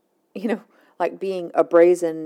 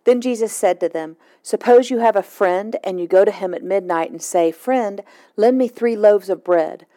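clear, louder Bible reading voice; scripture reading; 5 dB louder than usual. Acted. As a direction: read the passage aloud to listeners. Reads scripture passage aloud.

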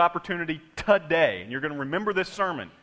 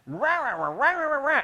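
a light imitation of a dad barking; mock barking; 8 semitones higher than usual. Father barks at his kid, lightly mimicked rah rah. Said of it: "he started barking at them".

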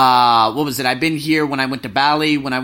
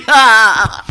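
loud laugh; 5 semitones higher, 10 dB louder than usual. Real, not acted. Laughing at his own line.

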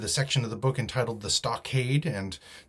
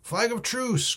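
crying out; 13 semitones higher, slower than usual. A narrator's reading. Silver answers the captain's challenge, high cry. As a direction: call it up and across to the enemy. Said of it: "cried Silver".

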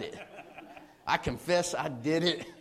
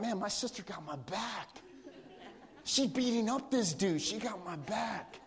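put-on awed little kid; 3 semitones higher, 7 dB quieter than usual. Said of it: "And I was like".

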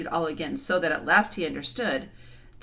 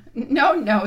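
laughing; 6 semitones higher, 9 dB louder than usual. Acted.

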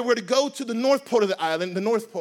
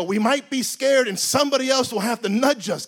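ordinary talk, nothing marked

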